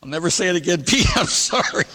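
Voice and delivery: high, laughing apology; laughing, caught-out apology; sheepish apology. Retold or imitated. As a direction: say sorry through a laugh.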